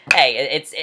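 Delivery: normal talking voice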